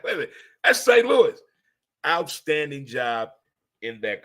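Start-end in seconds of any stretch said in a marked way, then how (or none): 0.0-1.4 excited recognition. Retold or imitated. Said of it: "I was like".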